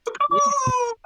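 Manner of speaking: piercing high bird screech; falcon caw. Acted. GM voicing the falcon monster, a high loud screech. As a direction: let out a shrill loud caw. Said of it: "you hear the falcon go".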